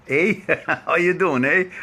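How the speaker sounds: put-on playful Canadian accent with a tacked-on eh; a light, laughing Canadian eh impression; mock Canadian. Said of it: "I don't know how to say it in Canadian".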